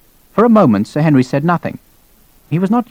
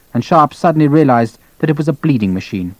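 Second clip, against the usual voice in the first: ordinary delivery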